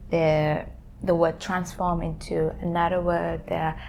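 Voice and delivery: normal talking voice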